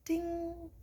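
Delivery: soft, high "ding"; high, held chime note, softly voiced; imitated chime. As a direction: ring like a gentle little bell.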